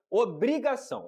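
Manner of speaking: Obrigação drawn out slowly, pitched high; stress on Obrigação. Real, not acted. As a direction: hit Obrigação hard and slow for emphasis. Repeats word alone for emphasis. (ênfase em Obrigação, lenta e aguda)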